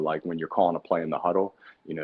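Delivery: normal talking voice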